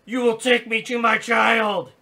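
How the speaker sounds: high, loud shrieking robot demand; robot scream. Acted. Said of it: "it screams".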